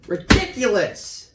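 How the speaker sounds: loud, high, slow scoff; indignant outburst. Acted. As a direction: throw it back in disbelief. Detective scoffs at accusation.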